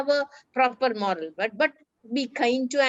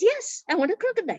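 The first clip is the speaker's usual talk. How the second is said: put-on eager boy; 9 semitones higher, faster than usual. Arun insisting on a crocodile.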